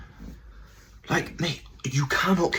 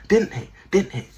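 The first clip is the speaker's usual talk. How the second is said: pitched up; 10 semitones higher, 3 dB louder than usual. Shown as a demonstration.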